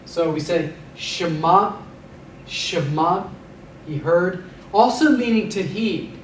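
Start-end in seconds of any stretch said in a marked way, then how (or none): 0.9-3.3 Shema, clear sh and open final a; Shema, teacher's model; Hebrew word. Shown as a demonstration. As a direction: say Shema slowly, stress on the end. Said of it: "We give the ayin a slight G pronunciation".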